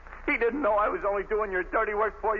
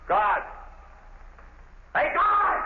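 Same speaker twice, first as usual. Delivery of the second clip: shouted summons, pitched up; calling out; 9 semitones higher, much slower than usual. Acted. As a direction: bellow for the guard. Prisoner calling the guard from cell.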